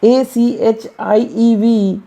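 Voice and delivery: letters spelled out slowly one by one; slow, deliberate letter-by-letter spelling. Shown as a demonstration. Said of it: "जैसे आप देखेंगे".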